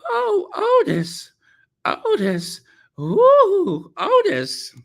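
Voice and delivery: mock yelling in a high falsetto; hammed-up swooning wail, leaping high; Aunt B. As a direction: parody a woman crying out in passion. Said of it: "the truth is she was yelling".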